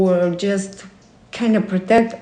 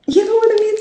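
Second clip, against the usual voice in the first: playful high aside; 12 semitones higher, much faster than usual. Acted. Aside in put-on voice.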